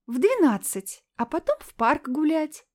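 sweetly sly singsong. Acted. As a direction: croon it like a sly fox. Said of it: "пропела она лисой".

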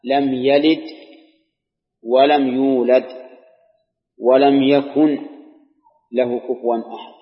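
slow, measured Quranic recitation. Acted. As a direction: recite it slowly and draw out each phrase. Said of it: "قال الله تعالى". (تلاوة قرآنية بطيئة ممدودة)

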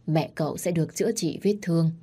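plain reading voice

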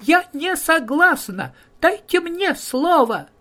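tiny high voice. Acted. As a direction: pipe up in a tiny helium voice. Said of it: "тоненький голосок её слышен".

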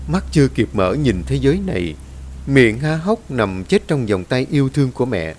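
usual speaking voice

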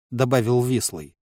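ordinary delivery

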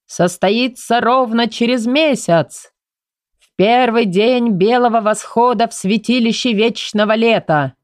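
slow, ceremonial herald's proclamation; formal announcement. A narrator's reading. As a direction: proclaim it grandly to the hall. Steward proclaims the wedding.

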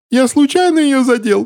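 very high-pitched squealing defence; pleading excuse. A narrator's reading. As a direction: squeal an excuse, cowering from a blow. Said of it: "оправдывался мужчина в фетровой шляпе".

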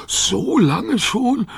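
very slow, high disbelief; dismayed dog. A narrator's reading. Rover shocked by the children's answer, slow high voice. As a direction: stretch every word, pitched high.

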